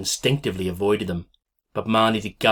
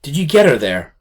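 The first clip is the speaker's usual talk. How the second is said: frantic question; 7 semitones higher, 8 dB louder than usual. Acted. Frantic mother asks about baby.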